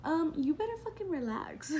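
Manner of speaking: mock-stern warning; indignant retort. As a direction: retell a fed-up comeback. Retold retort to yelling boyfriend.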